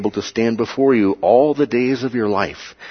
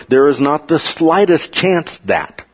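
slow, higher "not the slightest chance"; emphatic statement; 5 semitones higher, slower than usual. Shown as a demonstration. Teacher giving an English equivalent of a double negative, emphatic "slightest". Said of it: "That kind of emphatic statement".

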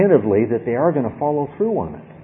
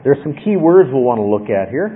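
normal talking voice

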